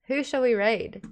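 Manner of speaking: normal talking voice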